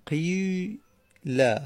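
قیل drawn out very slowly, the vowel of قی pulled toward a rounded u; ishmam demo. Shown as a demonstration. Teacher showing the class ishmam on قیل, drawn out with rounding lips.

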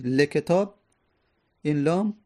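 usual speaking voice

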